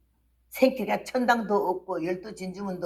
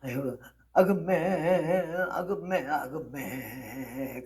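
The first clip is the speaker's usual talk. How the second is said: long, wavering dialect lament, fading away; imitated tearful wailing; 5 semitones lower, 4 dB quieter, much slower than usual. Church deaconess weeping over the dead speaker, imitated wail. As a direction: mimic a woman keening in Jeolla dialect. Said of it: "막 울면서".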